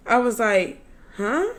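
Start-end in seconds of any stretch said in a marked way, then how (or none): 1.2-1.5 high rising questioning sound; puzzled, pitch jumping up; retold bafflement. Speaker hearing the passenger blame smoke for anxiety, baffled.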